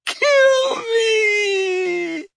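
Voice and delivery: very high, crying shout; anguished yell. A narrator's reading. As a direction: scream out in agony. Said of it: "he yelled".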